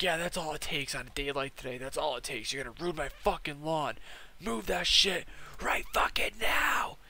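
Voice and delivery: angry boss imitation building to a high, strained yell; mock freak-out. Boss ordering delivery guy off his lawn, building mock rage. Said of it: "starts freaking out on the guy".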